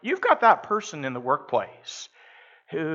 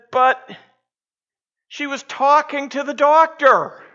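alarmed punchline; 11 semitones higher, 9 dB louder than usual. Retold or imitated. Patient delivers punchline in alarm.

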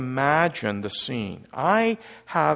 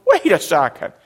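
raised, agitated protest; acted alarm, pitched up; frantic, stressed; 7 semitones higher, 6 dB louder than usual. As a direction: build to a high, loud outburst of disbelief. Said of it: "they're frantic and they're stressed".

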